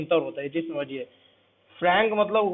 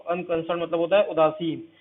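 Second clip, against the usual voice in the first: ordinary talk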